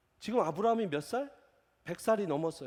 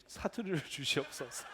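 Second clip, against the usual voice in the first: laughing prayer; 5 semitones lower, 6 dB quieter than usual. Real, not acted. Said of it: "아, 주님".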